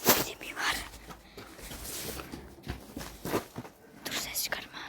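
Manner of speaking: hushed unvoiced whisper; whispering while hiding. Real, not acted. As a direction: breathe the words, no voice. Hiding from someone nearby.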